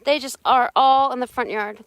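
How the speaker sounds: ordinary talk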